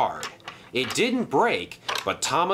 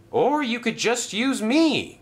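higher-pitched, eager call; eager offer; 10 semitones higher than usual. Acted. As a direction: pipe up brightly with an offer. New arrival calls out to engines.

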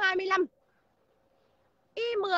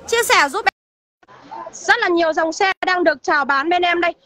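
loud calling out; 11 dB louder than usual. Real, not acted. Opening sales pitch shouted to viewers.

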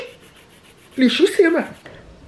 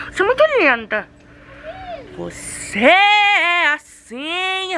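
high, drawn-out cooing to a dog; cooing pet talk; 9 semitones higher, much slower than usual. Real, not acted. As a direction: gush sweetly at a puppy in a high voice.

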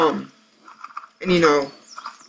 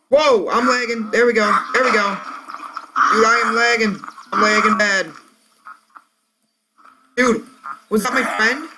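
high excited gamer yelling; hyped gamer bro; 6 semitones higher than usual. Real, not acted. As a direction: react big and hyped. Excited reactions in game.

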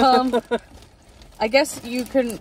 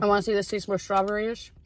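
talking between bites; chewing while talking. Real, not acted. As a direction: mouth partly full. Seen 1: Both people chew pink heart snacks while speaking; speaker unclear.

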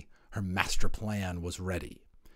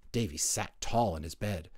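usual speaking voice

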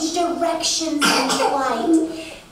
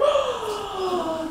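quieter gasp; soft gasp; 5 semitones higher, 4 dB quieter than usual. Acted. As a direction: catch your breath in wonder. Finding a spider.

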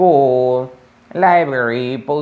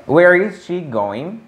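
slow model question; 6 semitones higher, slower than usual. Shown as a demonstration. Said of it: "teacher models question".

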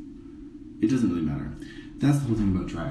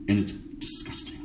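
grossed-out whisper; 7 dB quieter than usual. Real, not acted.

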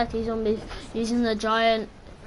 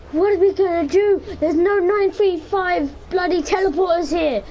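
higher, louder whiny panic; mock panicked teammate; 7 semitones higher, 5 dB louder than usual. Gamer mimics teammate's panicked muttering, hammed-up whine. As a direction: ham up a frantic teammate, near crying. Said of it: "you keep on whispering to yourself like".

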